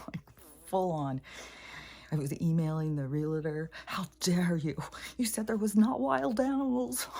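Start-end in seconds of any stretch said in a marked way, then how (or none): 3.8-7.1 mock outrage. Retelling her angry email to the realtor, hammed-up indignation.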